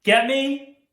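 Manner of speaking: loud, emphatic reprimand; stern scolding. Acted. School principal scolding a student for foul language, angry. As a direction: lay down the law, building to a loud finish.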